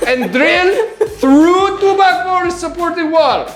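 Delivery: high, laughing mock Dutch accent. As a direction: lecture about the outlet in a hammed-up Dutch accent. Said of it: "You have to do it with a Dutch accent too".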